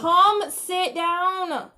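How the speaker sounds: slow, drawn-out command; weary mom command; stern order. Real, not acted. Mom ordering child to sit.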